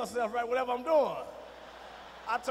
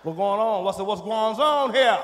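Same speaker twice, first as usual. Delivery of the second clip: booming confrontational question; loud demand; 10 dB louder than usual. Retold or imitated. Father barging in on the couple, loud demand. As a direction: burst in demanding answers.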